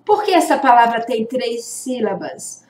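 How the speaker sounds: normal talking voice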